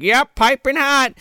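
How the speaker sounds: high, singsong; mock Missus Claus. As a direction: chirp it up high. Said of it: "She's like".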